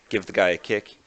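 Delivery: ordinary talk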